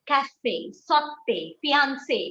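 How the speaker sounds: café, sauté, fiancé, each ending in a clear "ay"; accented final E sounded. Shown as a demonstration. Teacher drilling accented words, café, sauté, fiancé with final "ay". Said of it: "You give a A sound".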